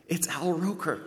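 high, thrilled aside to his wife; excited pointing out. Retold or imitated. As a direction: nudge someone and share the surprise. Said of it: "taps Michelle on the shoulder and goes".

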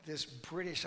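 ordinary talk

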